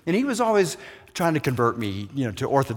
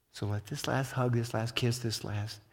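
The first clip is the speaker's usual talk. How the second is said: ordinary talk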